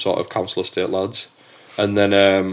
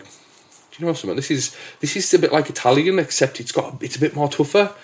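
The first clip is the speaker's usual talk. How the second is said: younger self voice; 6 semitones higher than usual. Retold or imitated. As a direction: lift the voice for the kid he was. Retelling his younger self's reaction.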